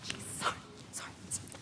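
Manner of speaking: breathy whispered sorry, barely there; hushed apology. Acted. Whispers apologies to the audience as her phone rings. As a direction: whisper sorries while fumbling with the phone.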